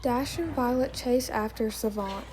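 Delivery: plain reading voice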